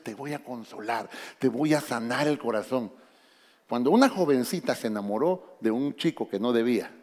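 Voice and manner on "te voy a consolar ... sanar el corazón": gentle promise. Acted. A lightly voiced God promising to heal his people, hushed. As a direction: lower and much softer, a lightly voiced tender God. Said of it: "Lo primero que Dios dice es".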